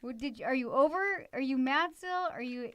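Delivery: mock startled reaction to a loving word; surprised whoa. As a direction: caught off guard by a sudden I love you. Mock surprised reply to daughter.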